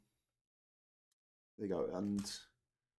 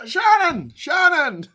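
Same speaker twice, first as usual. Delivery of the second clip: wobbly mock Irish accent, loud and high; hammed-up Irish-accented holler; Tom Cruise; 18 dB louder, much slower than usual. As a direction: shout in a bad Irish accent. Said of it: "Tom Cruise's wobbly attempt at an Irish accent".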